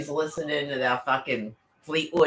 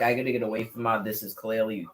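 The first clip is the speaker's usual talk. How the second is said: quick inner panic; 3 semitones lower, 4 dB quieter than usual. Retold or imitated. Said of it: "I'm like".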